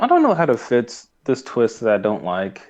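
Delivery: ordinary delivery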